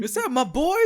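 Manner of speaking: high incredulous rise; shocked fan reaction; astonished. Retold or imitated. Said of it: "I was like".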